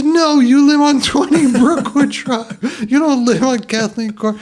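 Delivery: loud, high-pitched imitation of an outraged brother; mock exasperated yell. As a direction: yell the correction in comic exasperation. Brother contradicts Stuart, retold quarrel.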